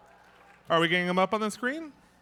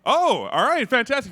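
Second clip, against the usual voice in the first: louder, higher cheerful surprise; a bright, higher, louder burst of thanks; delighted; 6 semitones higher, 6 dB louder than usual. Real, not acted. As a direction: greet the gift with glee. Delighted at a donation.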